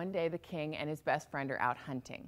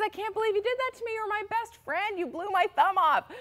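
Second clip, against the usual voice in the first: much higher, indignant outburst; upset king; 4 dB louder than usual. Acted. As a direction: accuse your best friend, outraged. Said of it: "the king is just so upset".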